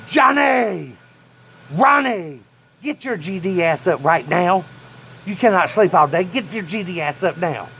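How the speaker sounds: high, loud angry bellow at the uncles; grandpa yelling. Retold or imitated. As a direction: roar them out of bed like a furious old man.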